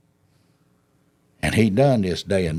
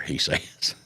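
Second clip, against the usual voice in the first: normal talking voice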